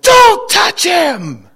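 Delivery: high, loud, drawn-out shout; retold yell. Dad stops a woman steadying the boy, yelled warning. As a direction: imitate dad's urgent warning yell. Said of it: "my dad yelled".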